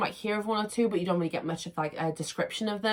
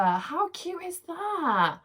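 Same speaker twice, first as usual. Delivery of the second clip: cooing delight; 10 semitones higher, much slower than usual. Real, not acted. Cooing over tumbler.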